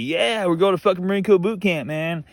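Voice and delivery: lightly voiced gung-ho recruit; happy-go-lucky. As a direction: imitate a cheerful, naive recruit. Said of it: "all fucking this happy-go-lucky".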